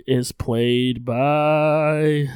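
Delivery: very slow, with "by" held long; drawn-out stalling. Real, not acted. Host reading who plays Mallory Brooke, drawn-out "played by" stall. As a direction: stretch "Mallory Brooke is played by" slowly, hanging on "by". Stalling while finding the name.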